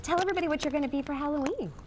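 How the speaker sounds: ordinary talk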